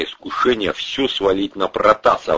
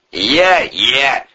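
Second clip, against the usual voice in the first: raised, loud sneering echo; mocking mimicry; 6 semitones higher, 5 dB louder than usual. A narrator's reading. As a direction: parrot the whiner mockingly. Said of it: "передразнил Порешайло".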